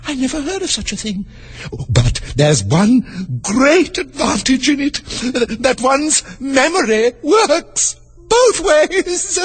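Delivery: high, slow, wandering queen; high, drawn-out royal voice. Acted. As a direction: muse like a dotty old queen.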